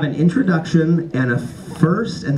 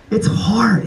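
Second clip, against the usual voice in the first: breathy whispered "It's hard"; 5 semitones higher than usual. Real, not acted. Sighs about writing the book.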